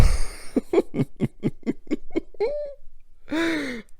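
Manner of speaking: long falling giggle of joy; high delighted laugh. Real, not acted. Delighted after the reveal.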